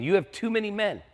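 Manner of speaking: pitched far up, emphatic; emphatic pronouncement. Acted. The Lord telling Gideon his army is too big, high and emphatic. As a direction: deliver it as a high, surprising verdict.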